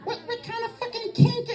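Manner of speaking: very high, strained falsetto; mock pained squeak. Man still hurting questions his partner, high pained squeak. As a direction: squeal it softly as if just hit in the groin. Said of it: "takes a second for the boys to come back down".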